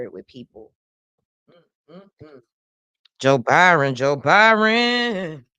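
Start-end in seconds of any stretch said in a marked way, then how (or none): 3.9-5.5 drawn-out sing-song name. Real, not acted. Repeating the news headline.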